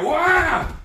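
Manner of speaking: sudden high loud "wow" as the attacker rushes in; mock surprise. Shown as a demonstration. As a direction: burst out in staged alarm. Demo of a charging attacker.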